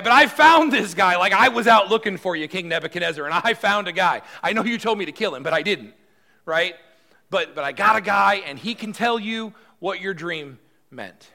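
high, loud, puffed-up courtier showing off; eager servant bragging to the king; self-important boasting. Acted. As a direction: brag like you found the answer yourself. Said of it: "Arioch makes himself pretty important".

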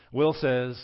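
ordinary talk

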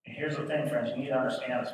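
ordinary delivery

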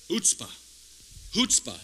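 "chutzpah" as "hoots-pah"; correct chutzpah pronunciation. Shown as a demonstration. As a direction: demonstrate "chutzpah" with an H at the start. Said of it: "It's pronounced chutzpah".

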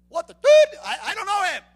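high, loud shouted denial; outraged shout. Acted. Peter shouting his denial at the accusers. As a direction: blurt it out in a panic. Preacher acting Peter's outburst.

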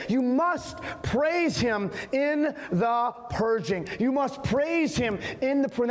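slow, rising preacher's exhortation; preaching. Acted. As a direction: preach it to the church. Preaching at full force.

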